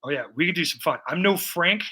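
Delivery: ordinary talk, nothing marked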